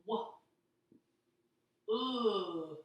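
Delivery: slow segmented wood, oo held; sounding out wood. Shown as a demonstration. Teacher sounding out wood for the class. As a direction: break wood into wo and ood, stretched. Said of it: "That first word is wood".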